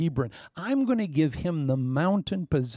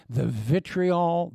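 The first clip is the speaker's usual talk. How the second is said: ordinary delivery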